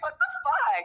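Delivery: high, loud disbelieving shriek; mock-shocked "what the fuck"; outraged disbelief. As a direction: squeal it in shock at his nerve. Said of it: "And I'm like".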